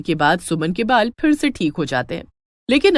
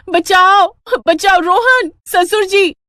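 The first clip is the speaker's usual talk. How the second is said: much higher, louder cry for help; screaming for help; 10 semitones higher, 6 dB louder, slower than usual. Acted. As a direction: scream for rescue. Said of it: "चिल्लाने की आवाज". (बहू का ऊँची, तेज़ चीख के साथ मदद पुकारना)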